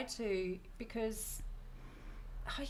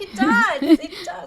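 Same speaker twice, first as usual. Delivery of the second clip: insistent mock Scottish; 9 semitones higher, 11 dB louder, slower than usual. Insisting her Scottish accent works.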